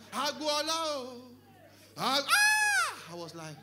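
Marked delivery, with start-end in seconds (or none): 0.1-1.2 high, drawn-out praise call; praise singer. Retold or imitated. Praise singer hailing the in-law, drawn-out high call. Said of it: "started praising me". 2.0-3.1 loud, high-pitched "ah"; excited cry. Retold or imitated. In-law being praised at the party, high excited cry.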